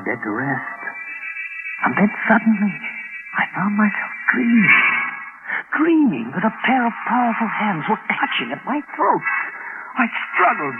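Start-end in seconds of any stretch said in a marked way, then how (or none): 5.8-10.8 high-pitched, panicked narration building to a cry; mounting terror. Acted. Man recounting being strangled in his sleep, mounting terror. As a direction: relive the nightmare, climbing higher to a stretched-out final line.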